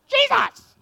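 very high, loud squawked cry; harsh bird-like shriek; raucous raven squawk. Shown as a demonstration. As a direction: screech the name like a raven. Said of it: "a raucous squawk sounding like ravens".

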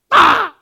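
loud, high caw; sudden piercing bird cry; raucous raven squawk. Shown as a demonstration. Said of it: "a raucous squawk sounding like ravens".

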